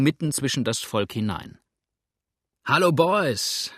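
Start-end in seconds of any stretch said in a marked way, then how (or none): 2.6-3.7 higher, louder, slow greeting shout; hearty call. A narrator's reading. Cook greets the men from the neighbourhood, a loud slow hail. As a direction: hail your neighbours across the crowd. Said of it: "rief er".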